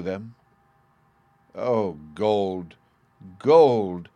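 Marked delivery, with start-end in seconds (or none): 1.6-4.1 mournful cry, the second gold louder; sorrowful cry. A narrator's reading. Siegfried over the dead brothers, sorrowful cry. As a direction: grieve aloud over the cursed gold. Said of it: "cried the hero sorrowfully".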